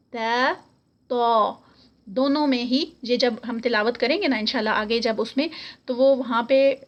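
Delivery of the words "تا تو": تا light then تو heavy and rounded. Shown as a demonstration. Teacher repeats the light and heavy pair for students. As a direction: set تا against تو. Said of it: "آوازوں میں difference دیکھیں".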